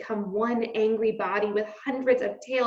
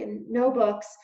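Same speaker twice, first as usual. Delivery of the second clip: ordinary delivery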